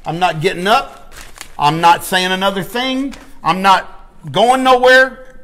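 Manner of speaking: loud, insistent revival plea; crying out in prayer. Acted. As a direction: dig in and cry out to God, stretching the last line. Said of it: "You need to cry out".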